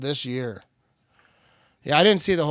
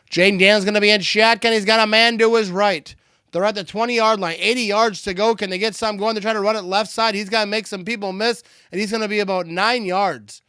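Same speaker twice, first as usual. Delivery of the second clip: higher, louder live football call; live play-by-play; 7 semitones higher, 5 dB louder than usual. Real, not acted. Streamer calling a run from shotgun, live play-by-play. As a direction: call the snap and run as it happens.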